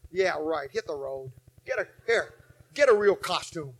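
low, soft brush-off; lightly scoffing at a cheap ghost; dismissive scoff. Retold or imitated. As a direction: wave the monster away, unimpressed. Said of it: "you just say".

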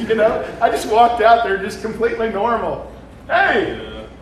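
laughing. Real, not acted. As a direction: tell it while still laughing at yourself. Laughing retelling of walking out.

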